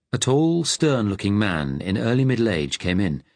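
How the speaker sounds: ordinary delivery, nothing marked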